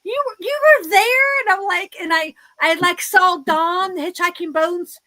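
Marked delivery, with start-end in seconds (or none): 0.0-1.4 high, loud astonished squeal of disbelief; jaw-dropped surprise; shocked disbelief. Retold or imitated. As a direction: act like friends who can't believe she showed up. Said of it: "And they're like".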